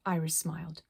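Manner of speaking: ordinary delivery, nothing marked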